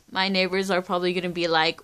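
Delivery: ordinary delivery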